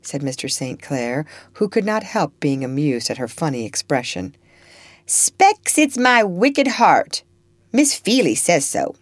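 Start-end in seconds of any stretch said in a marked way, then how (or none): 5.1-8.9 put-on higher, louder child's voice in dialect; impish Topsy. Child answers master about her behaviour, impish. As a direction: play a mischievous child owning up brazenly.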